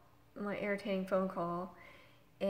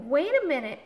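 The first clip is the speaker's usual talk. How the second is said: raised, much louder cut-in; urgent interjection; 6 semitones higher, 10 dB louder than usual. Retold or imitated. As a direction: call out to keep them on the line. Trying to stop the hang-up.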